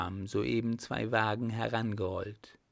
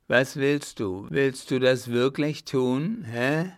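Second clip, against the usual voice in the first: loud, raised menacing questioning; threatening; 3 semitones higher, 6 dB louder than usual. A narrator's reading. Clown raises his fist at her, threatening. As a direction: demand an answer with a threat. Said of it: "erhob drohend die geballte Faust und fragte".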